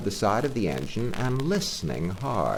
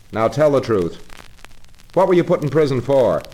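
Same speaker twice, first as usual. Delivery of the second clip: loud stern questioning; 6 semitones higher, 7 dB louder than usual. Acted. Engine driver sternly questioning Toad about his prison sentence. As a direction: demand the truth like a severe father. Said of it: "very sternly and said".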